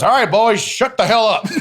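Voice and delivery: hammed-up boss telling the boys off; Teddy Long snapping. Wrestler imitates Teddy Long shutting the boys up, laughing mock scold. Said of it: "Teddy goes".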